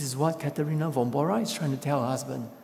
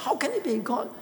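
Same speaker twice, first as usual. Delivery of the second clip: high, shouted outrage at his wife; angry yell; 11 semitones higher than usual. Acted. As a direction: cry out in disbelief and anger. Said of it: "was angry and yelled at his wife".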